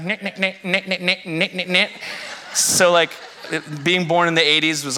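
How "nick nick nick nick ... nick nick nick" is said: imitated keypad clicks. Dad imitating nine-texting on a flip phone for daughter. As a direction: tap it out like old phone keys, lightly. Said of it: "Remember that?".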